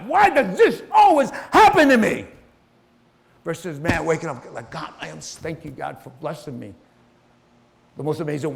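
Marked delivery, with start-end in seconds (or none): 0.0-2.3 higher, louder frustrated outburst; mock whiny shout. Someone whose life just sucks shouts in frustration. Complaining about life's misfortunes. 4.7-6.7 softer thankful words to God; soft grateful prayer. Retold or imitated. A grateful person quietly thanks God on waking.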